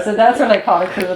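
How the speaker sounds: normal talking voice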